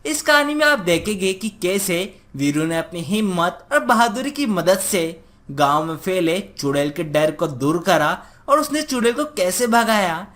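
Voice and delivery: ordinary delivery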